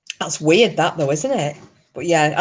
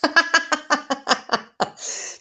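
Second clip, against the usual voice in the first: real high laugh falling away; a high, delighted laugh; high laughter; 10 semitones higher than usual. Real, not acted. Grandma cracking up at the grandchild's answer, high laugh.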